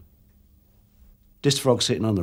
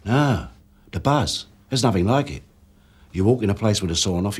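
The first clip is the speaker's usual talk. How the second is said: ordinary talk, nothing marked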